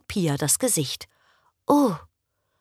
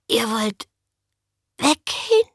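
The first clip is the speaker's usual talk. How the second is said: croaked. A narrator's reading. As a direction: croak it out in shock. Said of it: "krächzte sie". (entsetztes Krächzen)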